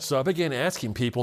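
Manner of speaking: ordinary delivery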